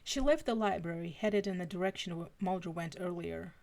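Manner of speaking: ordinary delivery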